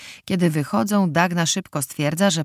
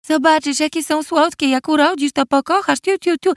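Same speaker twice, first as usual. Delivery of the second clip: high cooing mother voice; imitating her mother; 11 semitones higher, 4 dB louder than usual. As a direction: coo like a mother at a baby. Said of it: "naśladując głos swojej matki". (przedrzeźnianie matki gruchającej do dziecka)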